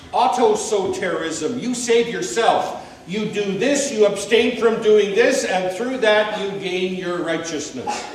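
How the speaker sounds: loud, pressing sermon delivery; emphatic preaching. Acted. Preacher denouncing self-salvation religions, loud. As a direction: hammer each point home.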